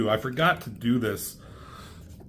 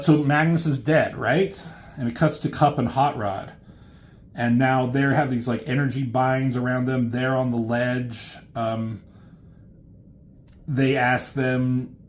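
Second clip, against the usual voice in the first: ordinary delivery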